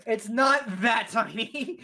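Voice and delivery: laughing protest. Real, not acted. Game master correcting the player on the creature's size, laughing. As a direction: protest while still laughing.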